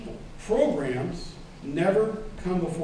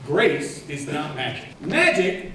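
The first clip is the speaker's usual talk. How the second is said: ordinary delivery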